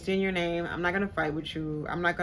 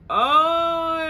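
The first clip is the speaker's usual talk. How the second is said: surprised oh; 10 semitones higher, 9 dB louder than usual. Retold or imitated. Retold reaction to being pushed out.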